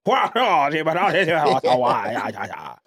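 garbled drawn-out mock British Southern babble; comic gibberish in a British Southern accent; British Southern accent imitation. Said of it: "that British Southern accent".

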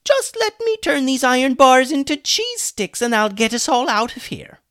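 very high, loud sarcasm; over-the-top mock eagerness; mocking joke. A narrator's reading. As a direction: pretend grand enthusiasm sarcastically. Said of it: "could see he was joking".

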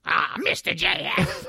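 put-on high whiny kid with a smoker's rasp; raspy kid voice. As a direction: whine like a kid who smokes. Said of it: "With, like, a smoker's voice".

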